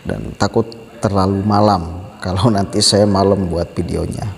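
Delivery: talking while smoking; cigarette in mouth. Real, not acted. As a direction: speak around a cigarette, relaxed. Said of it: "saya sambil merokok ya".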